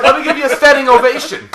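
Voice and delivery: loud, high laughing mock voice; mock shout. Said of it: "He's like".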